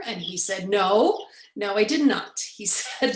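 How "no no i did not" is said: louder, firm retold refusal; emphatic denial. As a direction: deny it firmly, hitting the no. Friend firmly denies singing.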